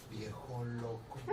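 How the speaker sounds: slow, quiet grumble under the breath; mother scoffing quietly at the pastor; muttered insult. Retold or imitated. Angry mother mutters about pastor.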